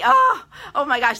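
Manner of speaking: thrilled at the thought; excited gasp. Real, not acted. Dreaming of a trip to Angela's studio, excited.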